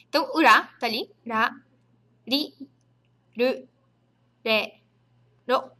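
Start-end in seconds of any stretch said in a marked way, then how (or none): none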